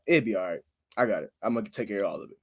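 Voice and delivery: low, soft mock composure; resigned self-reassurance. Man recalling how he reassured himself, quietly resigned. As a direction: drop low and talk yourself down.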